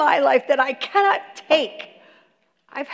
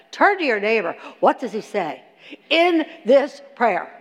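ordinary talk, nothing marked

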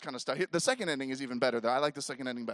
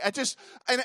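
ordinary talk, nothing marked

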